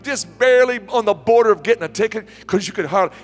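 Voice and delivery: loud, high-pitched interrogation of the groom; insistent questioning. Retold or imitated. Pastor pressing groom with rapid questions.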